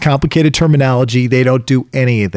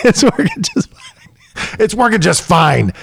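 high, laughing repeat; laughing, mock insistence; 10 semitones higher, slower than usual. Dad laughing while insisting the furniture works. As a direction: keep insisting through a laugh. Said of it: "you're like".

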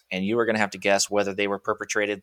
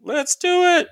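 much higher, louder game cheer; hyped-up shout; 6 dB louder than usual. Real, not acted. Hyping the Florida Man game.

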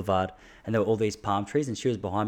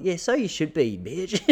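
smug mock reply; 7 semitones higher, slower than usual. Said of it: "No, I've never done that".

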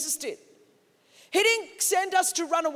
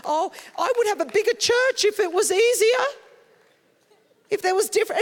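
higher, louder mock whine; hammed-up complaining voice, pitched up; whiny excuse; 7 semitones higher, 6 dB louder than usual. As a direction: play a pastor making excuses. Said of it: "It's not like".

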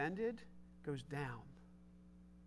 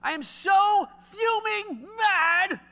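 high, loud, slow furious shout; fuming mad; 13 semitones higher, 11 dB louder, much slower than usual. Acted. Pastor acting out his marriage anger, shouting. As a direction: blow up like an angry husband. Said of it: "I said that like a married person".

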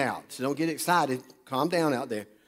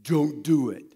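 conscience voice; slower than usual. Retold or imitated. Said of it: "that little voice speaks to you and says".

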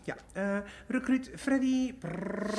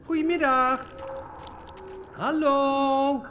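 calling out; 2 semitones higher, 5 dB louder than usual. Acted. Calling out at empty reception.

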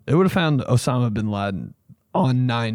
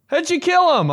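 loud, very high-pitched question; mock amazement; 5 dB louder than usual. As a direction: squeal it in put-on disbelief.

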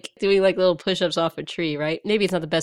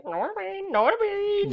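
high squawky parrot call; bird voice; 12 semitones higher, 4 dB quieter, slower than usual. Retold or imitated. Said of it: "Yeah, like".

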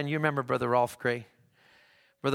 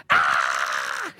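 long, loud imitated scream; mock scream; 13 semitones lower, 6 dB louder than usual. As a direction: scream like a startled little girl. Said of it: "she looks up at him and goes".

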